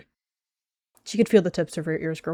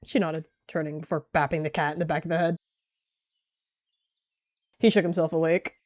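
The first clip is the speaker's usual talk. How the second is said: ordinary delivery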